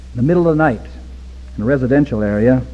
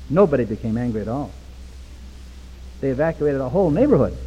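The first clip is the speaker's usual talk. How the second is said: ordinary talk, nothing marked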